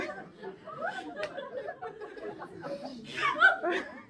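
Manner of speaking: long, high laugh falling away; high laugh trailing off; real laughter. Real, not acted. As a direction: crack up laughing. Medium laughing at deceased man.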